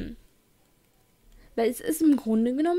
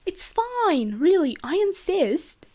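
higher-pitched, insistent British gentleman; lightly faked British accent, swooping higher; attempted British accent; 6 semitones higher, slower than usual. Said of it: "that I totally can't do".

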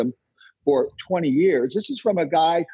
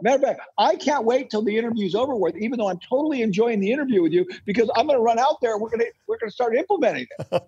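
eager excitement; 8 semitones higher, faster than usual. Real, not acted. Eager to go implement.

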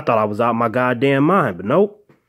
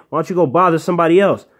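higher, louder, fast brush-off; exasperated; 6 semitones higher, 4 dB louder, faster than usual. Retold or imitated. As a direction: snap it off quick and fed up. Shooing away the ghost dog.

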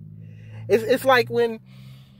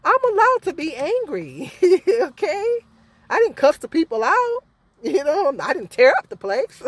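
retold protest; 7 semitones higher than usual. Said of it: "I said".

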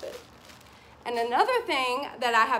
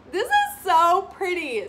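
much higher and louder, squealing with delight; thumbnail gush; 7 semitones higher, 7 dB louder than usual. Pretend. Vlogger hamming up a reaction for the video thumbnail, excited squeal. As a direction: gush it big for the thumbnail shot. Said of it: "Sorry, I had to do that".

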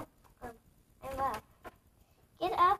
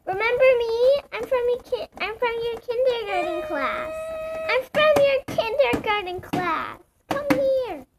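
high pleading toy voice; 9 semitones higher, 6 dB louder than usual. Pretend.

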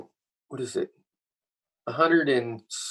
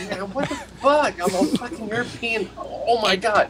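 mock outraged European; 10 semitones higher than usual. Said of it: "I'm like".

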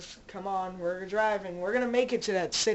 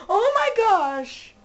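excited loud outcry; 4 semitones higher, 9 dB louder than usual. Real, not acted. Rescuing teammate Rexus from zombies.

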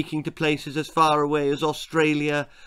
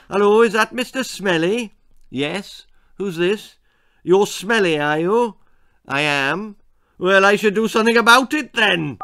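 prank caller; 7 semitones higher, 7 dB louder than usual. Acted. Prank phone call.

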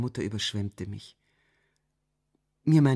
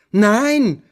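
much higher, much louder cry; loud shout; 11 semitones higher, 15 dB louder than usual. A narrator's reading. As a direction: shout no with all force. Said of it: "rief ich noch lauter". (Tochter schreit laut und hoch Nein)

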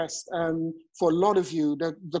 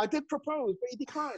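softer, higher, mock-rejected confession; mock hurt; 6 semitones higher, 7 dB quieter than usual. Joking to the audience about proposing to a friend, mock hurt. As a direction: play the jilted suitor quietly, as a joke.

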